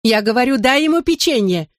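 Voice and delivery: high yelling, beside herself; furious yell. Acted. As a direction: scream at her sister. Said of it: "вне себя заорала".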